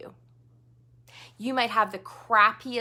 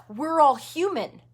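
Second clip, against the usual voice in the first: normal talking voice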